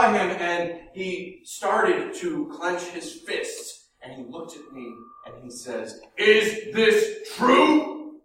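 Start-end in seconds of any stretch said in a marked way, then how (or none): 6.2-8.2 very slow, loud, menacing question; angry father. Retold or imitated. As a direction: hold back rage, drag each word out. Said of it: "was an angry man".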